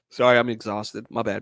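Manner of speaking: ordinary delivery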